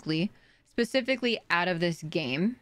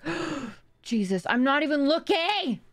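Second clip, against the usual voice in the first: startled scream; 8 semitones higher than usual. Real, not acted. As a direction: gasp then high frightened yelp. Jump scare from game.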